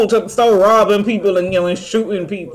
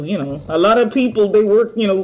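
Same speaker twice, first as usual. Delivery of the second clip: usual speaking voice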